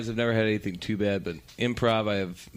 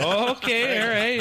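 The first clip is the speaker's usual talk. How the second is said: flustered host; 12 semitones higher than usual. Retold or imitated. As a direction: squeak out a nervous okay as the stunned host. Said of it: "He's like".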